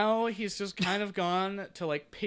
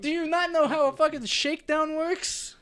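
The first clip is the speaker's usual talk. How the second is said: loud, higher exasperated thug snap; angry thug; 10 semitones higher, 4 dB louder than usual. Acted. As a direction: bark it at the stalling shopkeeper. Thug character loses patience.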